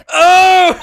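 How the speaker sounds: very high, loud exclamation; startled cry. Acted. Character reacts to witness in court, high loud startled cry. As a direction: yelp it out in mock shock. Acted startled outburst in game dialogue.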